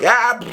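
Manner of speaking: short, high cry; mock startled yell. Enemies shot while reloading, high mock yell. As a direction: yelp as if caught off guard. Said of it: "They're like".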